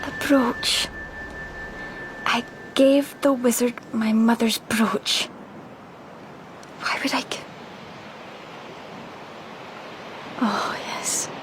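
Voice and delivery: dreamy whisper. Acted. Rhiannon whispering dreamily on the cliff edge. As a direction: drift the words out, far away. Dazed woman on a ledge.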